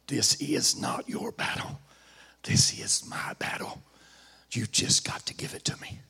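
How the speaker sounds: hushed voice of the Lord; whisper. Acted. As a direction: breathe it intimately, like a secret. God speaks to the king, whispered.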